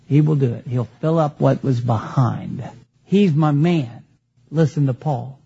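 low, deliberate, solemn authority; imagine the Lord answering, pitched well down; Jesus. Pretend. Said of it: "If Jesus walked in the door".

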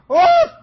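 high, loud mock woofs; imitated dog bark. Preacher imitating his dog wanting food, loud high bark. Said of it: "She used to go like this".

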